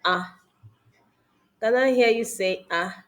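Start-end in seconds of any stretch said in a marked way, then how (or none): 0.0-0.4 Ah said as a short open ah; saying ahh. Shown as a demonstration. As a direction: give the Ah an open ah sound. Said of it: "examine the ha sound".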